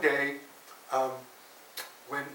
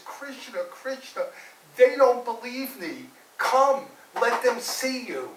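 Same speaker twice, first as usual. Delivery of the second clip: high plaintive plea, building louder; crying out; 9 semitones higher, 8 dB louder than usual. Acted. Upset boy calls Krishna to prove himself, pleading cry. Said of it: "he starts to cry".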